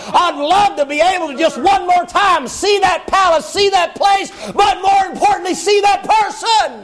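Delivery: preacher's rising cry of longing; rising preached cry. Acted. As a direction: build to a shouted longing. Preacher climaxing Mephibosheth's longing.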